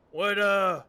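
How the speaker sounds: slipping into a Texan drawl; Texan accent by mistake. Acted. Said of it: "I'm going Texan, I'm sorry".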